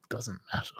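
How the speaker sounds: breathy whisper, barely there; whispered aside. Real, not acted. Shrugging off the van driver in a soft whisper. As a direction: let it go under your breath.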